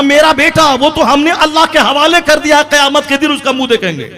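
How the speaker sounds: loud, very high declaimed answer; mother's stern refusal. Retold or imitated. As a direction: cry it out through the door, unyielding. Mother refuses son at door. (माँ का ऊँची, बुलंद आवाज़ में इनकार)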